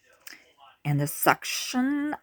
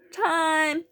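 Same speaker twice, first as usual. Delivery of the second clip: yelling; 13 semitones higher than usual. A narrator's reading. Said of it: "the pig holding her down yelled".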